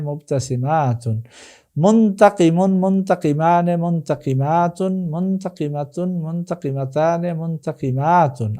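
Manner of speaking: rhythmic Arabic paradigm chant; drill recitation. Shown as a demonstration. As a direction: recite each form in a sing-song, stretching the long vowels. Chants Arabic word forms as drill.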